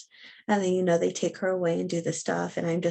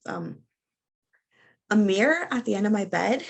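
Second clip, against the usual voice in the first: usual speaking voice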